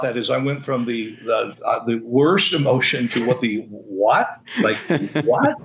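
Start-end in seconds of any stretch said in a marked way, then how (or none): 3.7-5.6 mock-astonished double take, pitch shooting up; stunned disbelief. Youth pastor retelling surprise promotion to senior pastor, stunned.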